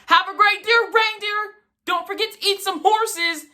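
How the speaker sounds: high, goofy sing-song mom voice; teasing mom. Retold or imitated. Mom calling out the car window, high and goofy. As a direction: ham up mom's silly goodbye.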